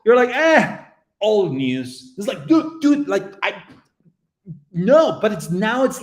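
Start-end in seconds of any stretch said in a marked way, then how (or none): 0.3-2.1 loud high "eh", then a drop; mock dismissive. Streamer mimicking viewers who lost interest, mock dismissive. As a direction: wave it off as worthless, imitating.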